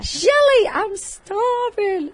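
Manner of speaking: very high, drawn-out animal voice; starving guinea pig. Acted. Guinea pig begging its owner for dinner, high and drawn out. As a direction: call out in a slow, high mock whine. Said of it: "so they're like".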